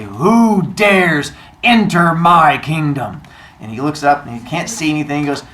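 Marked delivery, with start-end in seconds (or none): 0.0-3.2 slow, loud godly challenge; booming voice. Acted. Sun god challenging an intruder, booming. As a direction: boom it out, stretching each word. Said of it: "he hears a booming voice".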